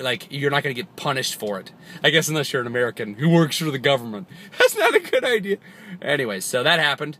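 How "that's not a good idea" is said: laughing. Real, not acted. Laughing joke aside.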